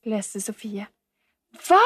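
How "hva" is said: sharp, shocked one-word exclamation; startled cry. A narrator's reading. Camilla hearing he won't return, crying out. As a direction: cry out in disbelief. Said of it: "ropte Camilla".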